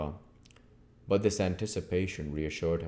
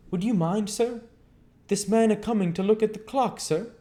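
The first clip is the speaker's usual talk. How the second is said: higher, lively innkeeper's wife; landlady voice; 7 dB louder than usual. Acted. Landlady asking the guest's leave, higher voice. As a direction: voice Mrs Hall, pitched up and eager.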